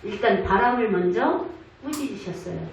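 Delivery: ordinary talk, nothing marked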